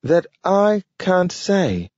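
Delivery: high, slow talking dog; a slow, careful talking dog. A narrator's reading. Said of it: "said Nathaniel".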